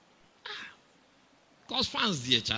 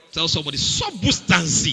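Central African accent; 5 semitones higher, 7 dB louder than usual. Shown as a demonstration. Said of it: "with a Central African accent".

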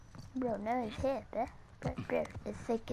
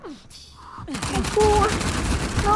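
louder, higher exclamation; alarmed outburst; 5 semitones higher, 7 dB louder, slower than usual. Real, not acted. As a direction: cry out in alarm. Alarm during game.

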